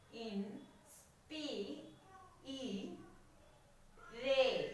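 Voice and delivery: "In, spi, e, re" in separate beats with long gaps; syllable by syllable. Shown as a demonstration. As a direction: sound out "In, spi, e, re" piece by piece. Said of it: "four syllables are there".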